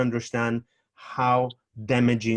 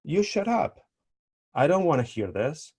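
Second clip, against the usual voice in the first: retort; 5 semitones higher than usual. Pretend. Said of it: "And you can say".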